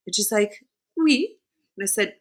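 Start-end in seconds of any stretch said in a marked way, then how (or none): 1.0-1.4 high, light French "oui"; mimicked French accent, pitched up. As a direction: imitate a Frenchwoman's polite answer. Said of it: "speaking with a very thick French accent".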